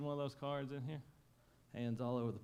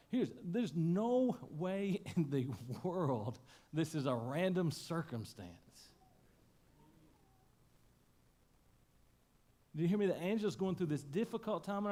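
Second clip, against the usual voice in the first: preaching; 5 semitones higher than usual. Acted. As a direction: drive it home to the room. Preacher climaxes the sermon.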